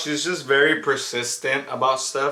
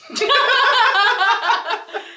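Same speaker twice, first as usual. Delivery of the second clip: high squealing laugh; 3 dB louder than usual. Real, not acted. Laughing after denial.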